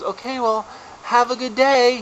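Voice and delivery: put-on high, sing-out child's farewell; imitated little girl. Ten-year-old to a crashed adult, imitated high cheery goodbye. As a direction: chirp it like a passing kid. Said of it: "this little ten-year-old girl".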